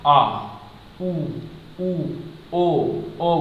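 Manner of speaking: आ, उ, उ, ओ, औ each said alone as a clear drawn-out vowel; vowel demo. Shown as a demonstration. Teacher to students, showing back vowels: clear isolated vowels. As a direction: model each back vowel slowly for the class. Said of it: "जैसे".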